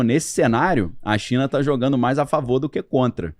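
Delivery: ordinary delivery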